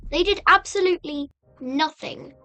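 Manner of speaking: usual speaking voice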